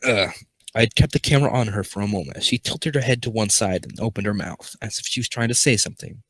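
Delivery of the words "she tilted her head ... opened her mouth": plain reading voice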